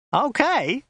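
high outraged echo; incredulous shout. A narrator's reading. Driver throwing passenger's question back at him, high shout. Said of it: "shouted Joe".

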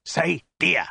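threatening, emphatic coaching; hissed prompt. A narrator's reading. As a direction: prompt with menace, word by word. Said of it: "hissed the soldier".

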